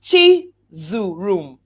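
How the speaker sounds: "Chizurum" drawn out slowly and firmly; slow deliberate name. Shown as a demonstration. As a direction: take your time over "Chizurum". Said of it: "take your time and say".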